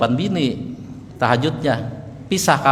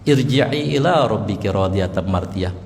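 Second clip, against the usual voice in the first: gentle spoken verse; 6 semitones lower than usual. Shown as a demonstration. Preacher speaks the verse gently to the congregation, calm. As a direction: say it tenderly, like soothing a soul. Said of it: "Wahai jiwa yang tenang".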